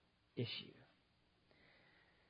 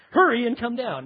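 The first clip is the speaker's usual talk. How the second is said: loud, high call up into a tree; commanding shout to someone overhead; 7 semitones higher, 10 dB louder than usual. Acted. Jesus calling up to man in tree.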